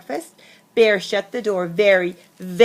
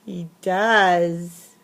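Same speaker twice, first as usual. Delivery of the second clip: ordinary delivery, nothing marked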